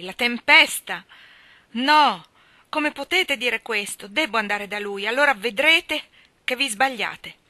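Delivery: loud, high, insistent protest; vehement exclamation. A narrator's reading. Mally telling the ladies they are wrong, in loud protest. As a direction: protest forcefully, refusing to believe it. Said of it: "esclamò Mali".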